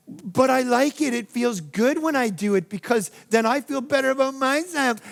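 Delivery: a lightly voiced petulant child justifying gossip; whiny kid. Pastor voicing a gossiper whining to God. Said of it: "you're like a whiny kid".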